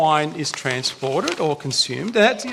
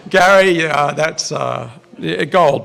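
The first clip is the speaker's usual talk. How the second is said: laughing; 3 semitones lower, 3 dB louder, slower than usual. Real, not acted. Still laughing at Gary's joke.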